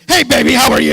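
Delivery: put-on booming, high greeting shoved at a baby; loud flat greeting to baby. Shown as a demonstration. Preacher mocking adults booming at a baby. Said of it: "we don't say".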